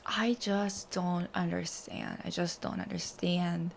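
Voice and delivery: baffled. Real, not acted. Reading about a boss's boundary crossing, quietly baffled. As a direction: say it very softly, half to yourself.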